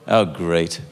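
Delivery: very low, unimpressed doctor; deadpan dismay. Retold or imitated. Doctor hearing of patient's penis problem, deadpan dismay. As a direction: drop it low, thoroughly underwhelmed. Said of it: "I swear she did this".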